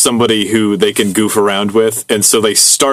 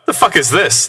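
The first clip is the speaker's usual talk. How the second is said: higher-pitched, baffled quoted outburst; dumbfounded blurt; 7 semitones higher than usual. A narrator's reading. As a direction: read it as a mildly stunned blurt. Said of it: "I was dumbfounded and blurted out".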